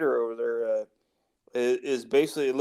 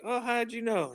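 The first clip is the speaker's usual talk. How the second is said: mock southern caller; 10 semitones higher, 4 dB quieter than usual. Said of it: "And they're like".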